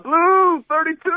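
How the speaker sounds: high, slow yelled snap count; yelled play call. Retold or imitated. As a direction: shout the play call like a quarterback. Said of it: "she yells out".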